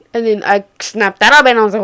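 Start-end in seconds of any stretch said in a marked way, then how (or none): none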